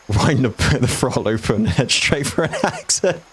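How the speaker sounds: laughing through the words, pitch climbing; real giggles overtaking the explanation; cracking up. Real, not acted. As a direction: start to lose it, ending in a high laugh.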